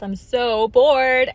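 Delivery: loud, slowly stretched mock-bored reply; hammed-up, dragged-out boredom; drawn-out bored complaint. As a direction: stretch it out loudly as playful boredom. Acting out her bored text reply.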